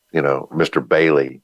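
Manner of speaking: ordinary talk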